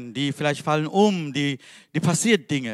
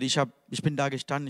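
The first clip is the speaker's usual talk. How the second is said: ordinary delivery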